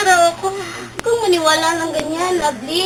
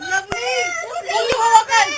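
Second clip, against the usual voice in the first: crying shout; 9 semitones higher than usual. Acted. Friend cries out in alarm.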